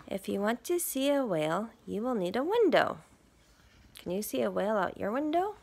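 lively picture-book read; reading to children. Acted. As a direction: read it to little listeners. Reads picture book aloud.

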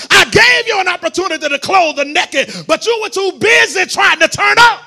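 loud, rising preacher's cry; preaching shout. Acted. Preacher warning the congregation, loud impassioned preaching. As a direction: bring it home to the church at full force.

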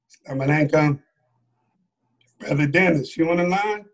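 measured, low roll call from the boss; Minister Farrakhan. Retold or imitated. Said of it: "Minister comes on".

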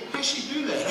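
high bewildered question; amazed question. Retold or imitated. Friend asking the preacher in high amazement. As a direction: ask it in wonder. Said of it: "he said to me".

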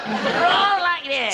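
slow, high, exaggerated Sandhurst officer accent; extraordinary Sandhurst accent. Old man imitates a fellow's Sandhurst accent, slow and high. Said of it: "a most extraordinary accent".

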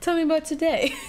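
lightly voiced gentle therapist. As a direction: ask it like a therapist. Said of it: "looks like a therapy session".

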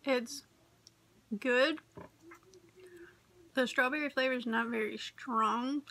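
chewing while talking; eating from spoon; mouth full of jello. Real, not acted. Seen 0: spoon of jello at mouth before, lips working while talking.

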